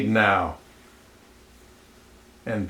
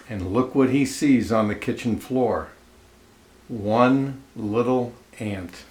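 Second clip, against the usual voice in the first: plain reading voice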